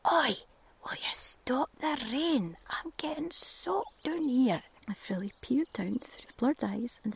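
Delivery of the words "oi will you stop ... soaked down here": mock shout. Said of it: "a voice shouted".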